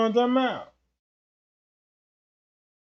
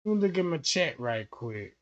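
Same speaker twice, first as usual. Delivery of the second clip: ordinary delivery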